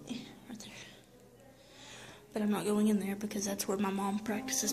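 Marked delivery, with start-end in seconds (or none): 0.1-0.7 whispering. Real, not acted. Filming quietly inside church.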